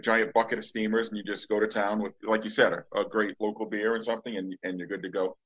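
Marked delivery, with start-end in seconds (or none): none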